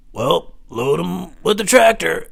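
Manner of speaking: high, slow put-on boss voice; lazy boss brushing it off, slowed down; manager's voice.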